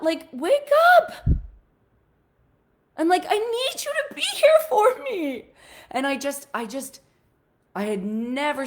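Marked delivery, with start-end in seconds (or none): 0.0-5.4 much higher and louder desperate plea; pleading shout. Retold or imitated. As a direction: demand he show up for her. Daughter pleads loudly with dad.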